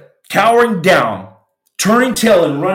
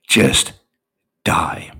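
lower, breathed almost unvoiced; whispered surrender; 12 semitones lower than usual. A man giving up before the shooter, whispered. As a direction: let the words die away to a whisper.

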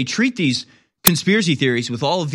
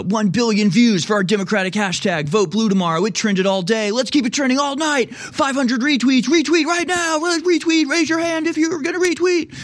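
shrill rising begging, higher and higher; mock Twitter hype; 9 semitones higher than usual. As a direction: parody a desperate retweet beggar. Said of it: "they're like".

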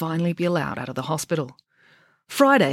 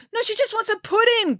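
high, urgent protest; calling out. Acted. Friday to the medical staff, calling out. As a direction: call out much higher to stop them. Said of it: "called Friday".

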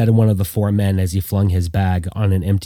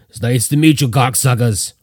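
wet, gargling growl of a voice; gargled voice; 2 semitones higher than usual. Acted. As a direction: gargle the words out from bed.

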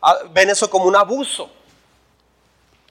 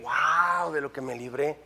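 much softer, lower wow of relief; a soft, awed sigh of relief, lower; hushed relief; 5 semitones lower, 12 dB quieter, slower than usual. Retold or imitated. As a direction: breathe out the narrow escape. Relieved recollection.

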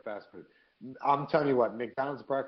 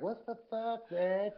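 annoyed onlookers; 11 semitones higher, slower than usual. Retold or imitated. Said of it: "every person at the kiosk like".

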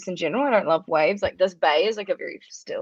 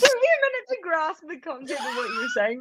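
laughing; 12 semitones higher than usual. Real, not acted. Cracking up at friend's joke.

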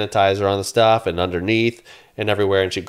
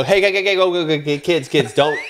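put-on calling to kids; 5 semitones higher than usual. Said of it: "I am telling them through the gate".

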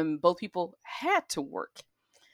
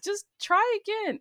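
very high sing-song try again; exasperated suggestion. Retold or imitated. Telling sister to retry, high exasperated coax. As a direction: coax her, wearily. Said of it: "I was like".

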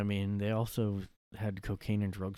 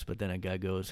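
ordinary delivery

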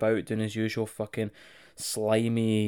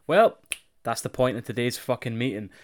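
usual speaking voice

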